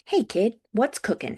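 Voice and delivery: put-on breezy greeting with a touch of British accent. A narrator's reading. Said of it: "in his British accent".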